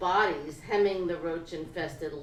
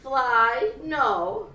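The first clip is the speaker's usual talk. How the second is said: loud, high, amused denial; emphatic exclaim; 5 semitones higher, 9 dB louder than usual. A narrator's reading. Narrator scoffing loudly at flying.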